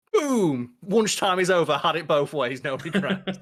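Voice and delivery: exultant mock boast, high and loud; gloating triumph. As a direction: crow over a beaten enemy. Acting out Holt's gloating triumph.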